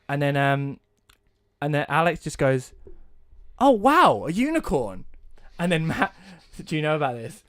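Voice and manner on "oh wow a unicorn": high, loud wonder at a discovery; amazed delight. Retold or imitated. Alex spots a unicorn in the game, amazed delight. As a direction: exclaim it like an awestruck player. Acting out friend spotting unicorn.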